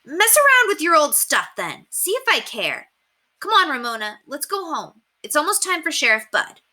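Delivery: put-on high, huffy girl voice; sulking big sister walking off; hurt girl's huff. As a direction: Beezus brushing off the boys. Beezus hurt, stalks off.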